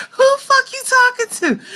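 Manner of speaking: high, laughing mock outrage; laughing disbelief. Mother squeals at her daughter's cheeky answer, laughing.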